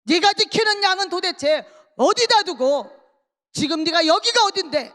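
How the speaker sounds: high, loud angry telling-off; acted scolding shout. Eliab berating his youngest brother at the battlefield, scolding shout. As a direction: shout it like an outraged elder brother. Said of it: "야단을 치고 소리를 지릅니다".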